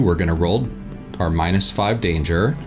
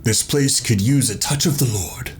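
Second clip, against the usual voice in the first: pious churchgoer; 5 semitones higher than usual. Acted. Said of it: "what a church person would say".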